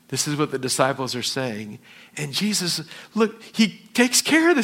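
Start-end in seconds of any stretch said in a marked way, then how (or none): none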